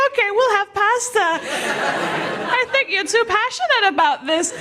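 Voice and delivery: high, sweet, still-smiling voice; unfazed cheerful friend. Acted. Punched friend still smiling and agreeing to pasta, high-pitched. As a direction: concede brightly through a fixed smile.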